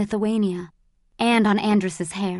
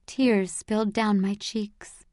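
plain reading voice

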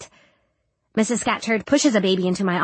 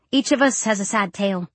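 ordinary delivery

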